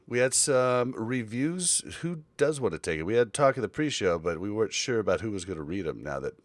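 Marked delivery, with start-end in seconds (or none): none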